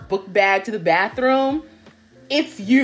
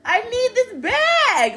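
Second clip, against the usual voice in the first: very high, drawn-out retold plea; slow, high-pitched dramatic insistence; exasperated insistence; 12 semitones higher, much slower than usual. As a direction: stretch every word in exaggerated frustration. Retold argument with the teacher.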